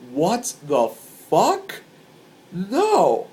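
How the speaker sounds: very slow, rising sharply at the end; incredulous question. Retold or imitated. Concertgoer hearing of a shooting plot, incredulous. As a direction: stretch each word in disbelief.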